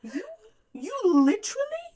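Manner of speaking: hammed-up indignant lecture; mock scolding. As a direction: spell it out in mock disbelief, word by word. Mock scolding the book's characters.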